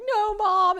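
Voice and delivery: high, loud whiny "No, Mom"; whiny teen protest. Retold or imitated. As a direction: push back like a sulky kid brushing off mom. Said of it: "of course, I was like".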